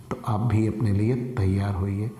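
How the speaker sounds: usual speaking voice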